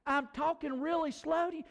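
indignant retold thought; exasperated disbelief. Retold frustration at bank.

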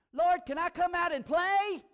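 high, loud, eager plea; hammed-up excited calling; playful childlike shout. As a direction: holler like a kid asking to play. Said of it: "Tony Evans said".

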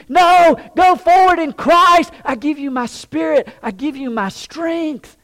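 loud, high, emphatic preaching; impassioned command, easing slightly in volume; forceful exhortation. Acted. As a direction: urge them onward as God speaking. God answers the complainer with exhortation.

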